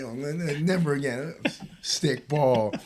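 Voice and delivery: usual speaking voice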